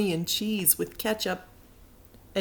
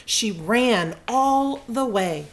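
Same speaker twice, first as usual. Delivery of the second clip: plain reading voice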